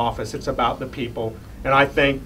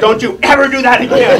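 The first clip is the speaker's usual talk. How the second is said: high, loud retold telling-off; mock scolding; 11 semitones higher, 10 dB louder than usual. Father scolding son after a scare on the phone, mock outrage. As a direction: exclaim it as an exasperated parent, for laughs.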